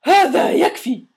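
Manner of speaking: loud, high, slow furious shout; angry shout. A narrator's reading. Neighbour screams at the boy, furious and loud. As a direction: roar it at the boy. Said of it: "صرخ السيد بلوط".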